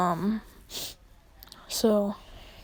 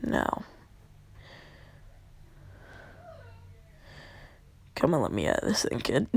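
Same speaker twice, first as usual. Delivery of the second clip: Kurama; 5 dB quieter than usual. Acted. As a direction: drop well down for a caged beast's introduction. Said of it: "And he says".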